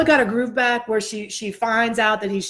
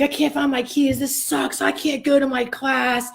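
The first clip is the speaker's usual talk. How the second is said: retold angry ranting; 5 semitones higher than usual. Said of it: "you know, swearing".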